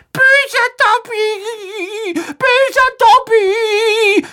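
very slow, very high elf shriek. A narrator's reading. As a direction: scold yourself in a frantic cry. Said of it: "schrie er".

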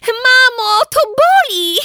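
very high, loud crying complaint; high loud whimpering cry; pained wail. Acted. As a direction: wail that it hurts. Bitten again, crying out.

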